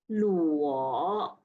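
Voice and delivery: "luo" low dipping, third tone. Shown as a demonstration. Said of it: "Line number fifteen".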